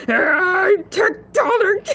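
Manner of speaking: slow drawn-out shout with a crying edge; stretched shout. Acted. Shark host proclaiming himself tectonic in a slow yell. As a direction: drag the words out in a big yell.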